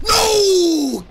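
long, very high, loud wail; anguished scream. Real, not acted. Player losing the fight, screaming in anguish. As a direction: scream it out and hold the vowel.

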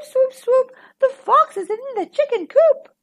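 high, loud crying owl. A narrator's reading. Owl calls the fox in the coop, high loud cry. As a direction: wail it as a panicked owl. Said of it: "cried Owl".

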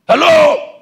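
high, urgent preacher's shout; full-force preaching. Real, not acted. Preacher to congregation about expecting bishop's support, full-force preaching.

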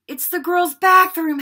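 horrified realisation, high and loud; gasped alarm. A narrator's reading. Boy realising where the troll is locked, gasping. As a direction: blurt it out aghast. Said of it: "Harry gasped".

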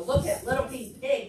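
ordinary delivery

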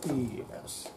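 ordinary delivery

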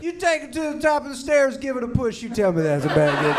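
loud, high, offended father defending the gift; indignant dad. Retold or imitated. Dad indignantly defending his Slinky gift to his son, loud and high. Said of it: "my dad was like".

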